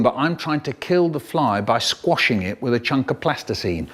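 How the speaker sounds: plain reading voice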